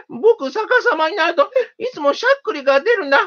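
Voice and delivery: high little mouse voice, relieved. A narrator's reading. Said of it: "ほっとした声で言いました".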